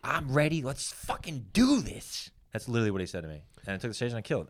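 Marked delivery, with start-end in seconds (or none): 0.0-2.3 slow, higher-pitched hype without volume; psyched up. Retold or imitated. Quoting the pep talk before taking the stage, psyched up. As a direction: draw out the last words, pumped but contained.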